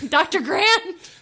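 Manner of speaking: drawn-out mock yell, slow and high; yelling Doctor Grant. Actress calling for the doctor, mock yell. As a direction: call out Doctor Grant across a jungle. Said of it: "Just yell".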